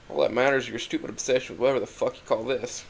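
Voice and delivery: ordinary delivery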